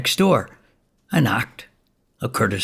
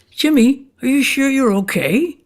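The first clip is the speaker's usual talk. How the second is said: worried disbelief; 12 semitones higher than usual. Retold or imitated. Narrator quizzing a suddenly healthy Jimmy, high and incredulous. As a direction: press him, amazed and a little worried. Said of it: "I'm like".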